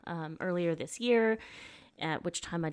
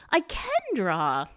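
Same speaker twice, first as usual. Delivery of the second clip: Abby's cheerful can; 4 semitones higher, 5 dB louder, slower than usual. Retold or imitated. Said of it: "and say".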